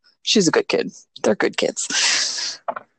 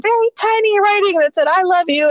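high-pitched imitation of tiny writing; tiny cute voice; 9 semitones higher than usual. Voices child's tiny written message.

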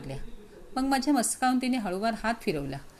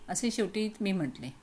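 ordinary delivery, nothing marked

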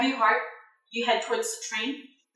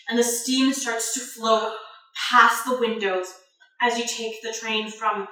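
ordinary delivery

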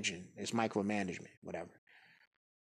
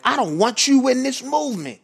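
much higher and louder, saucy furious tirade; aggressive godfather; 13 semitones higher, 7 dB louder than usual. Retold or imitated. Leader berating a member on the conference call, aggressive yell. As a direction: lay into him like a godfather scolding a minion. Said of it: "He was talking like that".

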